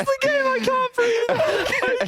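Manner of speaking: very high, slow laughing. Real, not acted. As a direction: squeak it out through laughter. Laughing fit.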